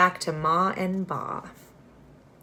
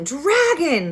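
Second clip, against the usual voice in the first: higher, louder yell; screaming; 9 semitones higher, 3 dB louder than usual. Acted. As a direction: shout in panic. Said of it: "they screamed".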